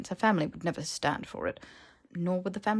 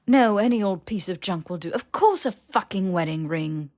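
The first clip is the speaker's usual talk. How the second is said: exasperated sarcasm; 6 dB louder than usual. Acted.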